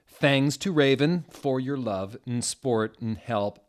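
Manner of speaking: lightly voiced teen gushing, slow; a hint of a gushing teen; mock gushing author. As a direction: thank your bestie in a put-on girly tone. Reading the author's thank-you note.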